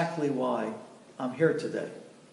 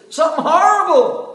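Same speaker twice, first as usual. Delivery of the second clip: horrified cry; 12 semitones higher, 10 dB louder than usual. Acted. Widow's horror bursting out.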